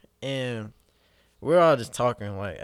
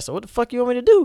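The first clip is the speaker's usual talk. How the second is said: much higher, louder, fast yell; panicked outburst; 12 semitones higher, 9 dB louder, much faster than usual. Retold or imitated. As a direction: blurt it out in a frantic yell.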